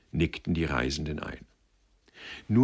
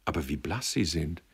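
usual speaking voice

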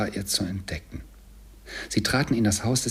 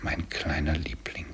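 soft, low, subdued grief; tender lament, voice sunk low; understated weeping; 4 semitones lower, 6 dB quieter than usual. A narrator's reading. Bob mourning his little darling, softly tearful. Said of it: "weinte Bob".

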